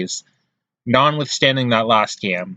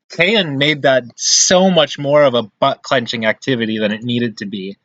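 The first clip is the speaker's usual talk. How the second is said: ordinary talk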